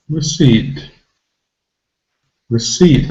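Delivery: "Receipt" with re reduced to a schwa; weak first syllable. Shown as a demonstration. Teacher demonstrating the schwa, first syllable weak. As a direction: barely voice the re, stress CEIPT in Receipt. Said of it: "And the first syllable, it's really simply".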